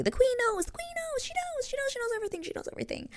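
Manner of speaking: high, squeaky mock panic; frantic retold quote. Eloise telling Penelope the queen knows, high mock panic.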